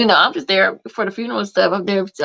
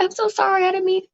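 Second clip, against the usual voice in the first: imitating crying lady; 13 semitones higher than usual. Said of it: "she was just crying".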